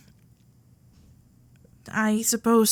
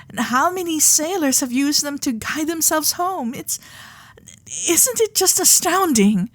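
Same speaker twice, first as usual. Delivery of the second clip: carried away; 5 semitones higher than usual. Acted. Heiress gushing to her lord about the stars, excited. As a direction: get swept up in wonder. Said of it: "getting carried away again".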